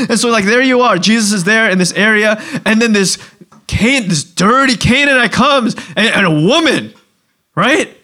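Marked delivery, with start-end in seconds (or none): none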